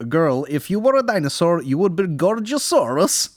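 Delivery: high, loud mock Russian accent. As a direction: play a Russian joke teller. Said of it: "a Russian accent".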